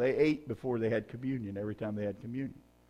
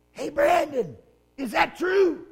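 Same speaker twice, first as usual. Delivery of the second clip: high, louder mock call across the church; wife calling out; 12 semitones higher, 4 dB louder, slower than usual. Wife asking husband a question during the service, high mock call. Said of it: "you would go".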